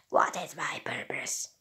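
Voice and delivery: breathy whispered robot voice. Acted. Robot asks its purpose.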